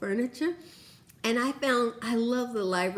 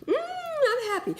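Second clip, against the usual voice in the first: higher, giggling, singsong "I'm happy"; giddy happy; 12 semitones higher than usual. Retold or imitated. Answering her happy brain chemicals, giggly and high. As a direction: giggle through it like a tipsy kid. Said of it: "And I'm like".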